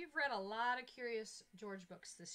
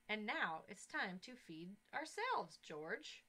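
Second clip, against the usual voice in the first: put-on reading to children; 4 dB louder than usual. A narrator's reading. Teacher reads story aloud.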